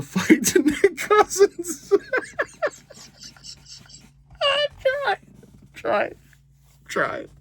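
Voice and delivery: words rising into a helpless high squeal; crying with laughter. Real, not acted. Fan telling who Scotty lost a fight to, cracking up. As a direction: break down laughing mid-sentence, squeaking. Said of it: "I'm trying not to cry".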